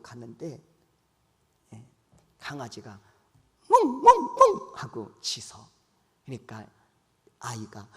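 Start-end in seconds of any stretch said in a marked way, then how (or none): 3.7-5.6 high, loud playful woof woof; mock dog bark. Preacher mimics a dog barking at the child, loud and playful. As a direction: bark like a little dog for the kids.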